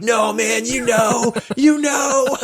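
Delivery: high, teasing yell of you know; knowing mock shout. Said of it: "And they were like".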